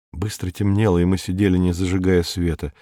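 ordinary delivery

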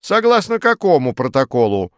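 loud, insistent query, well above the tired reply; demanding challenge. A narrator's reading. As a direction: demand to know, pressing hard. Farfurkis challenges the weary commandant. (требовательно, громко, с вызовом)

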